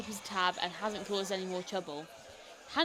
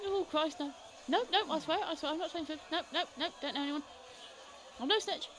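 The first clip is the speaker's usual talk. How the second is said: high, jittery denials; nervous informant denying everything; panicky snitch; 6 semitones higher than usual. Acted. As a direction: squirm out of it, high-pitched. Informant squirms.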